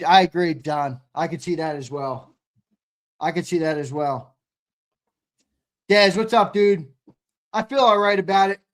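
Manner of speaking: usual speaking voice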